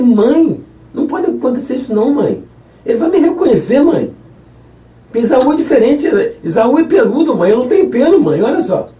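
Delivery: put-on high, insistent young man's protest; Jacó objects to his mother's plan, high pleading voice; pleading son. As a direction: beg your mother not to make you do it, pitched high.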